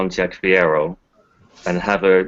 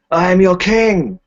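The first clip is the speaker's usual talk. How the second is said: high, loud, slow royal proclamation; roaring tyrant king; 11 semitones higher, 6 dB louder than usual. Acted. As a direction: bellow it down to the crowd. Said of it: "look down ... and say".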